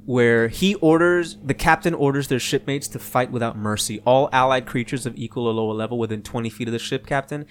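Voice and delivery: ordinary talk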